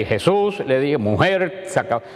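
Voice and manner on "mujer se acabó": stiff, solemn Gospel-reading tone; solemn church reading. Shown as a demonstration. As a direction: read it like a formal liturgy. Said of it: "siempre leemos el Evangelio solemnemente".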